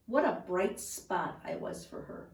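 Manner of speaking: plain reading voice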